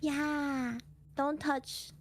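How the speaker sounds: ordinary talk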